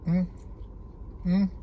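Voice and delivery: mumbling while licking fingers; muffled by hand at mouth; chewing with mouth full. Real, not acted. Seen 1: Eats pastry, then chews with fingers at his lips through the labelled words.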